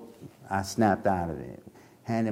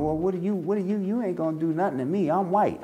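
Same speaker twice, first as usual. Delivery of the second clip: mocking the woman; 7 semitones higher, faster than usual.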